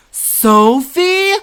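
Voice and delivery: loud, high call across the deck; father calls his daughter sharply. Acted.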